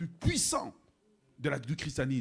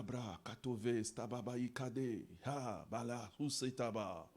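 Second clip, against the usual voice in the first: soft, low murmured tongues; speaking in tongues; 3 semitones lower, 7 dB quieter, slower than usual. Retold or imitated. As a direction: let the syllables flow quietly. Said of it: "Je parle en langue dans ma chambre". (parler en langues à voix basse)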